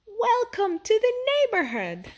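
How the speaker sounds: high, cheerful group welcome; neighbors greeting. A narrator's reading. The whole neighborhood greets Judy's family, high and cheerful. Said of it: "they said".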